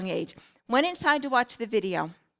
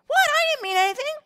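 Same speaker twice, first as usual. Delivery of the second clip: put-on much higher, whiny teen protest; defensive teenager; 13 semitones higher than usual. Teen protesting to a parent who scolds him, defensive and much higher. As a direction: play the innocent, offended teenager.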